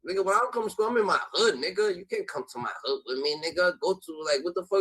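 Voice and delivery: normal talking voice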